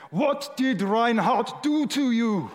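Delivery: put-on high, loud, slow German-accented demand; drawn-out outraged question in German accent. Strict father confronting the woman, loud German-accented demand. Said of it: "Doing my best German accent".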